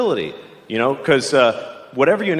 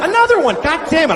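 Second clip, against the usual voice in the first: mock outrage; 14 semitones higher, 3 dB louder than usual. Acted outrage, hit again.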